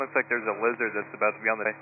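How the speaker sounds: ordinary talk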